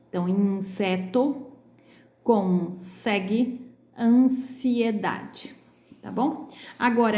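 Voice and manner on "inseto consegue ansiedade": slow syllables with voiceless S in "inseto", "consegue", "ansiedade"; S, not Z. Shown as a demonstration. Teacher listing exceptions, strong S in "inseto, consegue, ansiedade". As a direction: draw out each word, keeping the S sharp. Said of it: "não tem esse som de Z".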